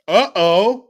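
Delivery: playful alarm; mock alarmed uh-oh. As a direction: tease them with a rising uh-oh. Louder repeated uh-oh.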